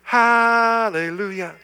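slow, high, ringing hallelujah; drawn-out hallelujah. Real, not acted. Pastor answering the troubled, long joyful hallelujah. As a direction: stretch it out in praise.

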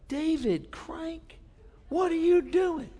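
high, slow, drawn-out scolding wife voice; imitating wife. Wife catching her husband snacking, high slow mock scold. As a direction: ham up the wife catching him with the nuts. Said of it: "and she says".

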